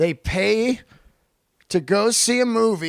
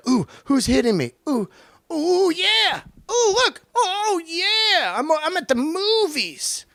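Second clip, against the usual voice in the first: much higher, squealing ooh-ing mock texter; giddy phone addict; 12 semitones higher than usual. Moviegoer gushing over a new text, high and giddy. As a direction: play a texter thrilled by every buzz.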